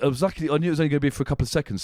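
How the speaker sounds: usual speaking voice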